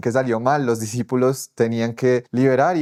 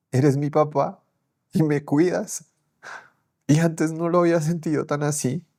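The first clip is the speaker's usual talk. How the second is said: high, weeping thanks; crying gratitude to God, pitched high; tearful prayer; 5 semitones higher than usual. Retold or imitated. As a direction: pray through tears. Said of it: "solo podía llorar y decía".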